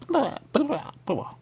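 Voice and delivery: high, odd mock gibberish. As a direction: babble it in a strange high voice. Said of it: "gibberish again".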